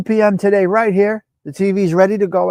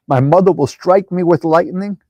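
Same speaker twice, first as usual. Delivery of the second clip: ordinary delivery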